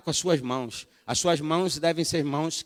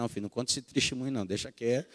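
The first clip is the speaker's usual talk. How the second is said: hurried laughing brush-off; 7 semitones lower, 9 dB quieter, faster than usual. Retold or imitated. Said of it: "Eu falei".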